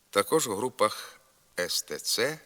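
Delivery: ordinary talk, nothing marked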